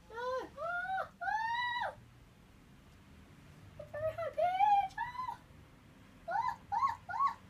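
very high, strained cries of panic; high distressed wails. Real, not acted. Gamer cries out in very high wails while being eaten. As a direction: wail high as you get caught.

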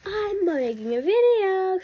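high, slow call to be heard downstairs. Retold or imitated. Kid calling downstairs to mom, high and drawn out. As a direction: stretch it out so mom hears from downstairs. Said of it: "so she could hear me downstairs".

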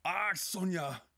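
loud, high, drawn-out irritated outburst; shouting over her. A narrator's reading. Irritated man drowns out Sonja, shouting. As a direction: cut across her with an exasperated cry. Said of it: "overstemde hij haar".

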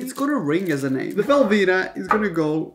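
ordinary talk

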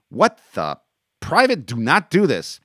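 loud, high protest; stern protest. Acted. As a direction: order him to stop, loudly. Soldier ordering a private to stop.

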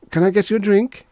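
high-pitched, loud offer; squeaky with nerves; nervous blurt. A narrator's reading. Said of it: "he blurted".

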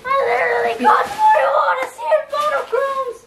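overjoyed, voice breaking with joy; excited crying shout. Real, not acted. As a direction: shout out the amazing news. Dziecko dostało wymarzone gry.

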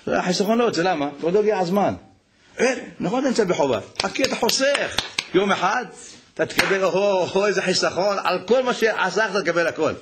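high, impassioned preacher's call; fervent preaching. Acted. Rabbi urges listeners to turn to God, preaching fervently. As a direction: rouse the congregation.

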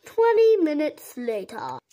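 loud caption-style announcement; announcer voice. Pretend. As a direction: announce the time skip. Announcing a time skip.

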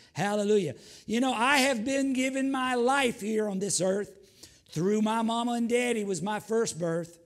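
rising, loud preacher cadence; fiery preaching. Acted. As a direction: preach it out to the congregation. Preaching at full force.

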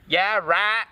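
usual speaking voice

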